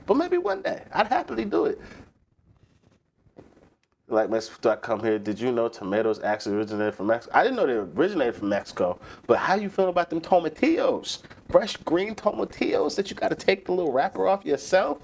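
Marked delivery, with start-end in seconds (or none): 0.1-1.8 squeaky high voice, playful and dreamy; high put-on voice. To chat, daydreaming about a taco truck in a squeaky high voice. 9.3-15.0 lightly raised, teasing put-on voice; teasing high voice. To chat, teasing about fresh tomatillos in a lightly raised voice.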